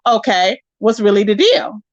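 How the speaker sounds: usual speaking voice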